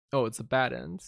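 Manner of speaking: ordinary delivery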